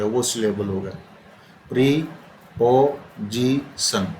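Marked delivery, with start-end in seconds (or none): 1.7-4.1 preposition very slow, four pieces with gaps: pre, पो, जी, सन; broken into syllables. Shown as a demonstration. Teacher showing how often the sound breaks, preposition syllable by syllable. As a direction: split preposition so each break can be counted. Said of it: "चार बार टूटी".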